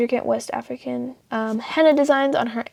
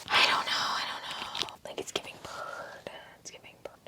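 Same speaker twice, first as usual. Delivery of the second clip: fading to a faint whisper; 17 dB quieter than usual. Real, not acted. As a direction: whisper it, dropping away.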